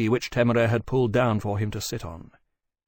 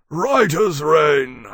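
big, loud, very slow, higher voice; Maximus, absent-minded; 5 semitones higher, 8 dB louder, much slower than usual. A narrator's reading. Maximus answering while eyeing Requiescat, absently. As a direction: stretch it out, attention elsewhere. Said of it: "Maximus said absently".

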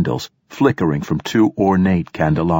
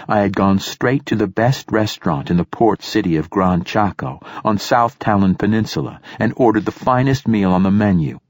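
usual speaking voice

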